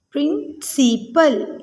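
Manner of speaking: "Principal" slowly broken into प्रिं, सि, पल; syllable by syllable. Shown as a demonstration. Teacher repeats for pupils, "principal" split into three syllables. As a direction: sound out "principal" in three beats. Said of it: "इसमें three syllables हैं".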